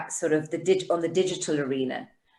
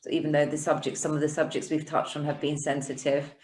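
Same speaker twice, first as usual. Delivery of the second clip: ordinary delivery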